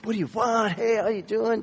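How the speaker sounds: high, bubbly server greeting; bursting with pep, pitched high; full of energy. Retold or imitated. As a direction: imitate an energetic young waitress greeting customers. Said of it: "just full of energy".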